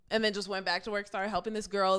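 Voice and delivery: usual speaking voice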